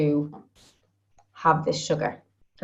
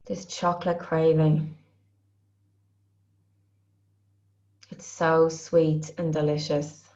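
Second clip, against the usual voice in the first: guided tapping phrases. Acted. Coach voices the group's craving while they tap, soothing and slow. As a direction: repeat each craving phrase calmly, one at a time.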